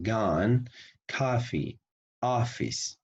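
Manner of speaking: "gone", "coffee", "office" said as "gahn", "kahfee", "ahfis"; American ah sound. Shown as a demonstration. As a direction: open the "o" wide to an American "ah". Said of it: "you have to pronounce like".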